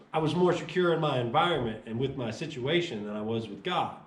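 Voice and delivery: ordinary talk, nothing marked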